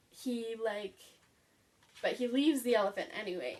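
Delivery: normal talking voice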